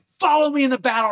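high, fast rallying cry; battle call. Retold or imitated. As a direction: charge the men forward. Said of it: "he's like".

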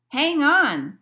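loud, high storybook shout; yell. A narrator's reading. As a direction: yell a warning to the passengers. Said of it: "yelled Barnaby".

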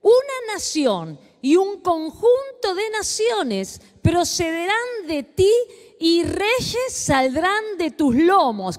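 preacher reading scripture slowly to the congregation. Acted. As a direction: read God's words slowly and weightily. Reads Genesis passage aloud.

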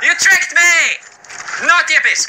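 high, loud outraged cry; high-pitched indignant shout; startled yell. Real, not acted. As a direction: yell in shock at being tricked. Yelling after jump scare.

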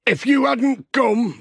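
put-on grateful old farmer. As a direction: let the gratitude pour out slowly. Narrator voices grateful Alf.

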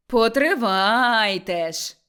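loud, drawn-out shout. Acted. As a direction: shout it slowly so the whole yard hears. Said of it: "крикнула Мотря на весь город". (протяжний гучний крик)

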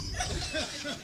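short high chuckle; real laughter. Real, not acted. Preacher amused by his own admonishing, soft high chuckle.

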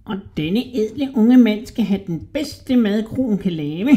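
high, squeaky, wheedling rat voice; sly little rat man. A narrator's reading. Little rat flattering a hungry Hodja, high and squeaky.

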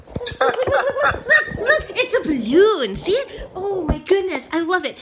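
excited squeal. Real, not acted. Shrieking over a free balloon.

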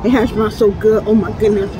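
ordinary talk

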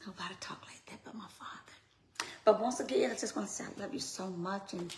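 ordinary talk, nothing marked

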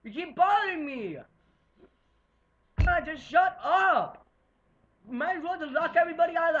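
loud angry yell at brother; angry shouting. Real, not acted. Yelling at brother off camera.

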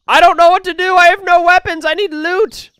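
high, loud helpless plea; real gamer panic; panicked whine. Real, not acted. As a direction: wail it with no weapons left. No weapons, being hunted.

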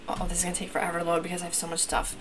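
ordinary delivery

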